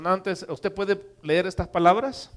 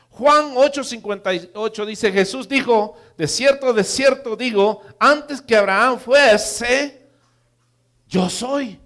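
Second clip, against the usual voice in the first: emphatic preaching; 5 semitones higher, 6 dB louder than usual. Acted. Preacher quotes Jesus on Abraham to the congregation, emphatic preaching. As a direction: build weight up to the final declaration. Preaches scripture at full force.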